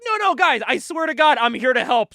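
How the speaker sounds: high, loud mock protest; frantic pleading. Host voicing hero facing armed guards, frantic pleading. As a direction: plead for your life, hammed-up and urgent.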